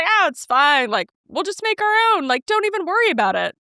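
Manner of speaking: high, friendly no-big-deal voice; cheerful reassurance. Shown as a demonstration. Scene: how she sounded to the restaurant girl, cheerful. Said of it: "if you were looking at me talking on the phone".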